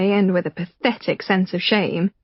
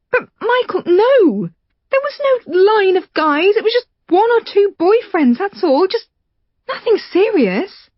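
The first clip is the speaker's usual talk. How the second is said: much higher, tearful protesting woman; Rachel pleading; 12 semitones higher, 3 dB louder than usual. Acted. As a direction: plead with a catch in the voice. Rachel defends herself, tearful.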